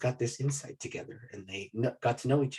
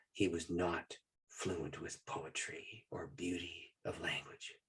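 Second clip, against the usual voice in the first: hushed; 3 semitones lower, 10 dB quieter, slower than usual. Real, not acted. Reverent aside about dad.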